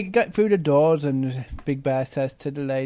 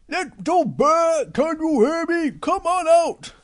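high, slow calling through glass; 10 semitones higher than usual. Acted. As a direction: call the cub slowly and high. Big Bear calls through glass.

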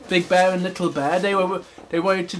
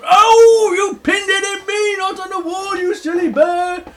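very high yelping bear; loud yelp into squeaky scolding; Big Bear; 14 semitones higher, 7 dB louder than usual. Acted. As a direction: cry out like a pricked cartoon bear. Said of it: "And Big Bear goes".

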